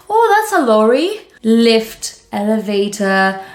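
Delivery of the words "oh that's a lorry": high, bright "Oh" that jumps way up and gets louder; mock-surprised local pointing something out; "Oh" jumps very high and loud, then "lorry" said casually; surprised Londoner. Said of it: "they hear".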